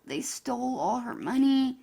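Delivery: ordinary talk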